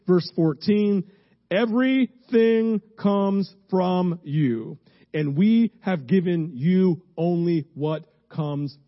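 loud, high, forceful scripture reading; preaching scripture. Acted. Pastor proclaiming First Chronicles to the congregation, loud and high.

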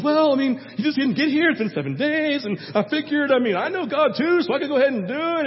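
hammed-up guilty king; excusing Saul. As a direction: talk your way out of trouble. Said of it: "And Saul goes".

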